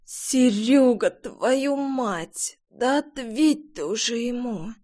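dry hoarse rasp, quiet. Acted. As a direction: croak it out with a parched throat. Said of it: "просипела я".

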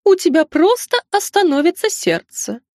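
high-pitched owl character voice; talking owl. A narrator's reading. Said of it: "подала голос сова".

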